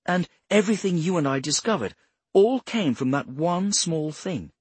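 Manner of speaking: high-pitched, marvelling explainer; amazed Bradshaw. Acted.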